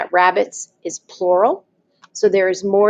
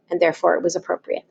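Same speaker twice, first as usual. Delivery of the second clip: ordinary talk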